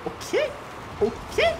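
squeaky high surprised okay; startled. Real, not acted. Gamer surprised by the play, squeaky startled okay. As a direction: caught off guard.

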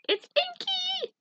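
high-pitched squeal, drawn out; excited squeal. Real, not acted. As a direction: squeal the name with delight, slowly. Excited name squeal at a card.

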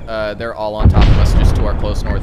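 urgent loud callout. Real, not acted. Urgent artillery call, enemies close.

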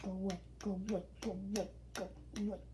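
fast, soft repeated shooing; rapid shooing. Real, not acted. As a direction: chant it quickly to shoo it off. Shooing the spider.

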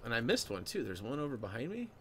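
ordinary talk, nothing marked

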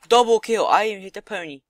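high, loud burst of glee; excited triumph. Real, not acted. Gamer celebrating a double kill in his game, excited. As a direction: call it out loudly, thrilled.

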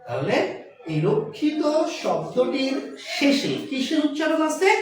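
usual speaking voice

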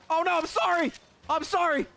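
flustered apology. Real, not acted. Apologizing to Cleffa.

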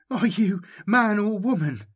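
put-on a terrified boy gasping at the voice; frightened gasp. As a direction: ask it with fear catching in the throat. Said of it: "he gasped".

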